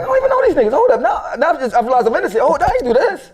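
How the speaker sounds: high, loud, laughing protest; half-laughing disbelief at the charge; incredulous realization. Retold or imitated.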